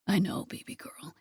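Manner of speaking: soft whispered reassurance; murmured whisper. Acted. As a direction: whisper it close to her ear. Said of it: "he murmurs".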